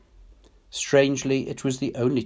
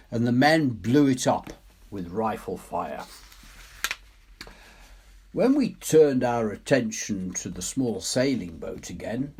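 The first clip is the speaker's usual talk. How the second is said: lightly voiced, brisk English officer recounting; a hint of a seasoned officer's account; naval officer telling his tale; 4 semitones higher than usual. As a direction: tell it like a clipped old navy man remembering. Officer's retold account in story.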